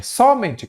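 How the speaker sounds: "somente" with the first syllable punched high, SÓ-mente; wrong stress. Shown as a demonstration. Teacher testing where the strong syllable falls, wrongly stressed first syllable. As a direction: stress the SO of "somente", pitched well up. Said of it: "Não, não tem esse acento".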